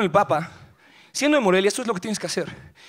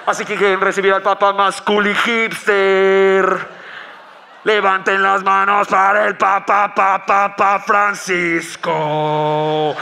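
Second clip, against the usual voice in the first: presentador lento y sonoro que alarga las palabras; anunciador de estadio; 2 semitones lower, much slower than usual. Acted. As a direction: anuncia al Papa como a una estrella de rock. Voz de presentador anunciando al Papa. (voz de anunciador de concierto, lenta y resonante)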